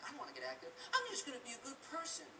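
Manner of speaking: high, whiny voice of a lazy do-gooder; mock complacent person. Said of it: "coming up and saying".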